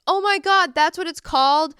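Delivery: loud, high excited realization. Retold or imitated. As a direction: blurt it out in sudden recognition. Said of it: "And I'd be like".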